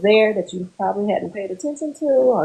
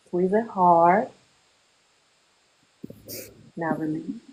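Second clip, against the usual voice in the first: guided relaxation; 4 dB quieter than usual. Acted. Counselor guiding a group through muscle relaxation, calm and unhurried. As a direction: talk them through tensing, then letting go.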